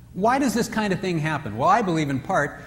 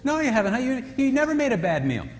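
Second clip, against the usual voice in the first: higher, a hint of an earnest husband; well-meaning husband; 9 semitones higher than usual. Husband praising his wife's cooking, lightly voiced earnest. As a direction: sincerely reassure her, pitched higher. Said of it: "he meant by what he said".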